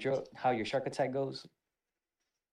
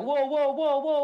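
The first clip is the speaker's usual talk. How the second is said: whoa; 8 dB louder, slower than usual. Retold or imitated. Startled recruit backing off.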